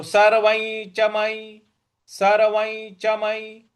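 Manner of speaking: सर्वं च मयि intoned, सर्वं gliding into "nycha"; slow level chant, ञ linking सर्वं to च; palatal nasal before च. Shown as a demonstration. Teacher modelling सर्वं च मयि, slow nasal link. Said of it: "इस प्रकार से बोलेंगे".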